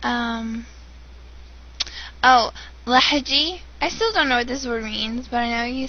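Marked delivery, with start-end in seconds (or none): none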